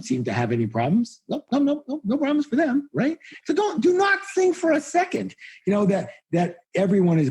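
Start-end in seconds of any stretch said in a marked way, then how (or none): 1.3-5.3 much higher, mock-indignant no no no; sarcastic squeal of denial; sarcastic high protest. Real, not acted. Speaker to audience about rich friends, sarcastic high protest.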